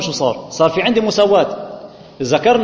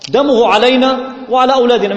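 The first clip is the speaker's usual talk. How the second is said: higher and louder, a crowd's cry for blood; crowd demanding, raised voice; 5 semitones higher, 4 dB louder than usual. Retold or imitated. Priests and crowd demanding Jesus's death, raised shout. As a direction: shout it like the mob calling for his death. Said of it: "وشو قالوا الشعب؟".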